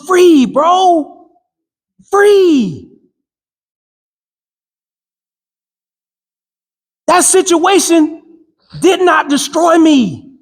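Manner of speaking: fervent preacher cadence; rousing motivational preaching. Acted. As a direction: drive it home like a sermon to the crowd. Preaching to a crowd.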